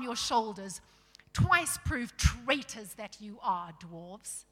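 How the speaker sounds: loud, commanding royal rebuke; stern king. Acted. Tyrion warning the traitor dwarves, stern and commanding. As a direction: declare it with force as a king.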